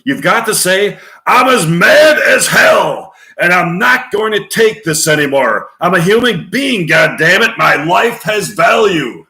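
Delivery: loud, high, impassioned shouting; mad-as-hell rant. Acted. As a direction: explode in outraged defiance. Acted Network 'mad as hell' rant.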